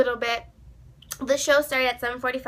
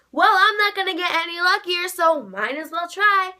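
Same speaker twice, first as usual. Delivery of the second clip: excited; 5 semitones higher, 5 dB louder than usual. Retold or imitated. Acting her own excited thought.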